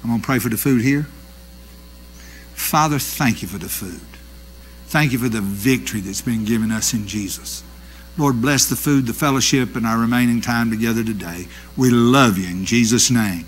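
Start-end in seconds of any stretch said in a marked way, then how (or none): 2.5-13.4 prayer. Real, not acted. Prayer over the food.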